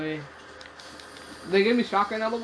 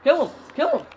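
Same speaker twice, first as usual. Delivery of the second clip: louder, higher urgent yell; 6 semitones higher, 6 dB louder than usual. Real, not acted. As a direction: shout the order. Yelling in game.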